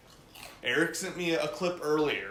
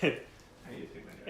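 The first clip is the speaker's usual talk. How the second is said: whispered greeting; 5 semitones higher, 7 dB quieter, slower than usual. Acted. Goofy greeting.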